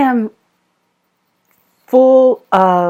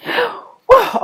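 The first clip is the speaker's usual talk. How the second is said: high gasp into an amazed whoa; gasp, stunned. Real, not acted. Tarot reader stunned by her cards, gasping.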